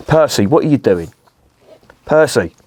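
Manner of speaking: mild real scolding of a pet; calling out to dog. Real, not acted. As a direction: break off and call the dog to attention.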